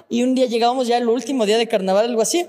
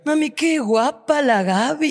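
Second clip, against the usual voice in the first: slow, sugary mock compliment to mom; teasing imitation of a friend gushing to her mother; mock sweet daughter; 3 semitones higher, much slower than usual. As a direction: drag it out like a sweet little girl showing off.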